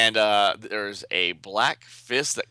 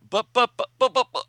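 much higher, louder flustered buts; sputtering; 5 dB louder than usual. Acted. Duplicate Boy sputters as she shoves the ring at him. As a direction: stammer helplessly, caught out.

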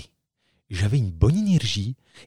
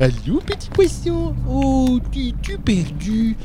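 put-on baby talk; 12 semitones higher, slower than usual. Talking to a fish.

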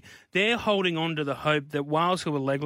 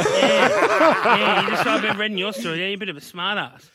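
laughing Eddie impression; 4 semitones higher, 8 dB louder than usual. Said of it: "my first conversation with him".

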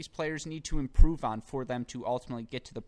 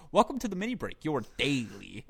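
imitating host intro; 3 semitones higher, 3 dB louder than usual. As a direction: mimic the podcast host's opener. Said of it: "he'll just go".

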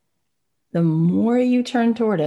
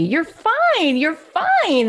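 high, breezy brush-off of the upset; dismissive mom; 12 semitones higher, 3 dB louder, much slower than usual. Retold or imitated. Mother hushing an upset baby in the study, high and dismissive. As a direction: chirp it higher, waving the feeling away. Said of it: "the mother starts to say to the baby".

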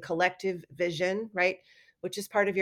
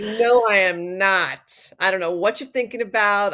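mock indignant denial; 7 dB louder than usual.

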